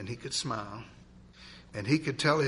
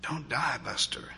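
whispered plea; 5 dB quieter than usual. A narrator's reading. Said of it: "he was saying".

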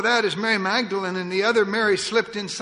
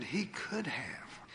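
hushed awe; 6 semitones lower, 14 dB quieter, much slower than usual. Real, not acted. Preacher hushes after "Oh, beloved.

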